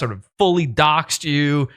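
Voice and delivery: normal talking voice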